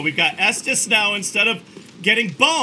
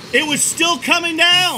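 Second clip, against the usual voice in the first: higher, louder insistence; insistent louder repeat; 9 semitones higher, 4 dB louder than usual. Real, not acted. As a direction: say it again, more emphatic.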